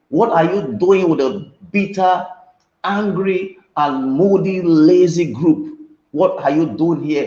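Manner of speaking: fiery preacher's refrain; preaching at full force. Acted. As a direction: hammer the question home to the congregation. Preaches the repeated question.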